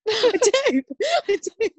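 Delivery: laughing. Real, not acted. Laughing agreement between hosts.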